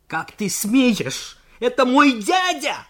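high, loud, slow-starting outburst of outrage; outraged shout. Acted. Junker defending his insulted uncle, high furious shout. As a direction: explode with wounded pride.

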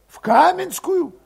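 put-on high, tense young Cossack voice; high-voiced young troublemaker; Valet's voice. A narrator's reading. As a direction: pitch him up high and edgy.

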